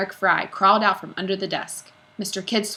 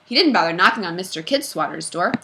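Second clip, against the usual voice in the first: plain reading voice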